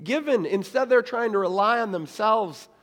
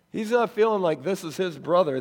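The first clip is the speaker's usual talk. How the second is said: usual speaking voice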